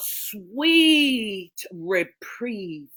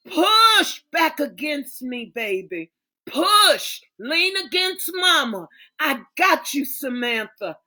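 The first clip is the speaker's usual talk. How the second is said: mother coaxing her daughter, higher and urgent; 6 semitones higher, 3 dB louder than usual. Acted. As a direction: urge her on like a mama at the bedside.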